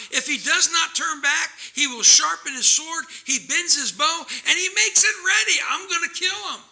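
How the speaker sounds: high, loud preaching fury; righteous anger. Acted. Preacher voicing God's judgment on the wicked, righteous anger. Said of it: "he's angry with the wicked".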